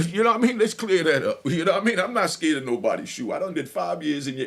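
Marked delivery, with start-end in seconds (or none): none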